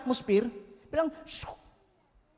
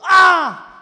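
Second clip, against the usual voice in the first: ecstatic cry; 6 semitones higher, 10 dB louder than usual. Retold or imitated. Overwhelmed cry in testimony.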